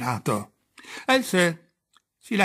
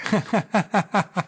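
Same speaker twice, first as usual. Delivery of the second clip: uncontrollable laughter; 6 semitones higher than usual. Acted. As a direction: laugh out in a helpless fit. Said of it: "Solo devo sempre ridere".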